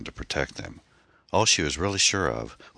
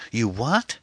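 high, startled blurt; blurted question; 9 semitones higher than usual. A narrator's reading. Anne to the obscene caller, startled blurt. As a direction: blurt it out in disbelief. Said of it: "Anne blurted".

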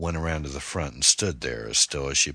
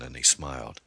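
ordinary delivery

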